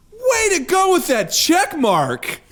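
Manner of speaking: high, slow condescending praise; imitated patronizing cheer. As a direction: praise like talking down to a small child. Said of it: "you're being super patronizing".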